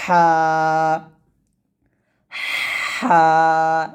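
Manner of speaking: হা thin with slight pressure; thin pressed ha. Shown as a demonstration. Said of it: "এরকমভাবে সুন্দর করে".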